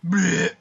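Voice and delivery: loud gagging blech; disgusted blech. Real, not acted. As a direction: spit out a grossed-out noise.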